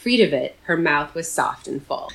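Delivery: ordinary talk, nothing marked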